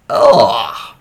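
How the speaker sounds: a loud, disgusted ugh from the gut. Real, not acted. Host reacting to a gross story, loud disgusted ugh. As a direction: recoil out loud at the gross detail.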